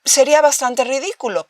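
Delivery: usual speaking voice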